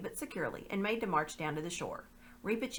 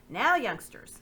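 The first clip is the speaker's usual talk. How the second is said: a lightly voiced slave trader, louder; coaxing captives, a touch raised; slave dealer voice; 5 semitones higher, 8 dB louder, slower than usual. Slave dealer to the children, lightly voiced.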